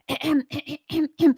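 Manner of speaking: exaggerated throat clear. Singer clearing phlegm off the vocal cords, exaggerated throat clear. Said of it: "You'll be like".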